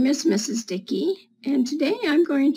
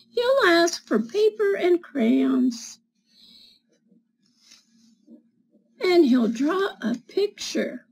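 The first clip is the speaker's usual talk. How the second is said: higher, lively picture-book reading; reading to children; 3 semitones higher than usual. Acted. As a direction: read it like storytime for little kids.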